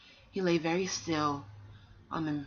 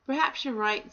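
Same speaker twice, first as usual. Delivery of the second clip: higher and louder, a lightly voiced boar; high boar reply; 7 semitones higher, 5 dB louder than usual. First boar agreeing with the other, higher and louder. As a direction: give the boar a lifted, agreeable voice.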